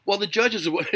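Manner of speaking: ordinary talk, nothing marked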